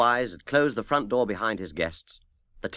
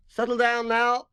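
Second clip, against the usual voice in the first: high, louder call to the band; shouted; 13 semitones higher, 3 dB louder than usual. Acted. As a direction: bellow across the studio to settle them. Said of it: "shouted Harry Fish".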